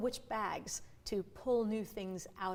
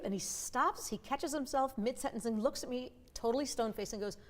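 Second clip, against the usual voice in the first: ordinary talk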